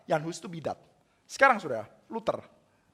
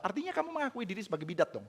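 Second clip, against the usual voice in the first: normal talking voice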